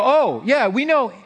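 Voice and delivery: high, loud, cocky "Oh, yeah, we know"; mock breezy trespassers. Trespassers answer dad, retold.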